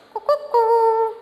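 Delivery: high hooted coo-coo-coo; hen call imitation. Shown as a demonstration. Teacher shows children how a hen will sound, high imitated call. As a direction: mimic a hen in high hoots, last one held. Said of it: "How hen will sound?".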